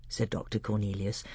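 plain reading voice

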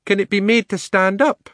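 very high, loud lady elephant; thoughtful elephant. A narrator's reading. She-elephant pondering a test, high-voiced and thoughtful. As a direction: wonder aloud in a high elephant voice. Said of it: "said the elephant thoughtfully".

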